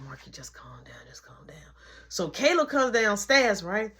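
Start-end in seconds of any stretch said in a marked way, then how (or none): 0.0-1.6 soft, hushed whisper to calm him; soothing whisper to a shaking brother. Retold or imitated. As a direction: whisper so mom won't hear. Calming brother quietly, mom nearby.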